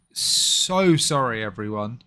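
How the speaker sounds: usual speaking voice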